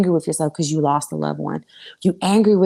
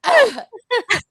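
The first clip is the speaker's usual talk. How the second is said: high, loud fake wail; hammed-up sobbing noise; mock bawling, pitched way up; Kim K ugly cry; 6 dB louder than usual. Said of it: "that Kim K cry".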